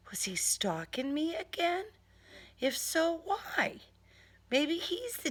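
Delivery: hushed, uneasy whisper; whispered worry. Acted. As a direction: whisper the fear to yourself. Anxious private thought.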